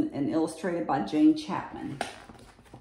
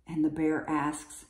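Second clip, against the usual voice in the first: ordinary delivery, nothing marked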